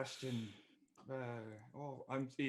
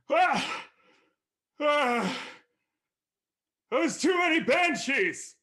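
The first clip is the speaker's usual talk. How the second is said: panicked dragon yelp; 13 semitones higher, 7 dB louder, slower than usual. Acted. Dragon panics over banshees.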